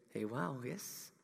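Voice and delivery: usual speaking voice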